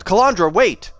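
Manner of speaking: loud high cry to stop her; urgent shout. A narrator's reading. Wraith shouting at Calandra mid-fight, loud. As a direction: shout her name to halt the blade.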